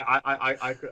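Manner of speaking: usual speaking voice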